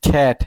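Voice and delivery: "খ্যাট" with an open short "a", as English cat; short vowel. Shown as a demonstration. Teacher proving the short-vowel rule, "খ্যাট" said with open a. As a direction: say "খ্যাট" with jaw open, no glide. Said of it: "short ভয়েল উচ্চারণ করছেন".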